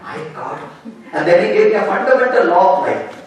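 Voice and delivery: normal talking voice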